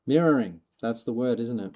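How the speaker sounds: ordinary talk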